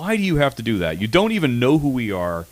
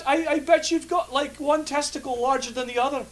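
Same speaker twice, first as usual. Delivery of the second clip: high squeaky comeback; mock Angus voice. Angus voice insulting the co-host, high and cheeky. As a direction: fire back a cheeky insult in a cartoon squeak.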